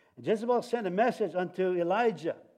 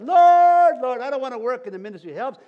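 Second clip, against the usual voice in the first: high, loud, fast whining to the Lord; mock a servant griping about his duty; loud, high, sulky mock moan; whiny complaint; 6 semitones higher, 13 dB louder than usual. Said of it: "Everybody has said that".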